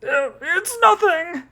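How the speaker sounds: high, slow, crying denial; hammed-up tearful cry of nothing. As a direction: squeal it out close to tears. Tanaka near tears, denying.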